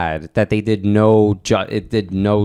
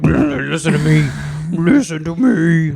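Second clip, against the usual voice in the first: higher, slow, strained mock growl; straining his voice on Listen to me; strained Batman voice; 5 semitones higher, slower than usual. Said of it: "he wasn't straining his voice".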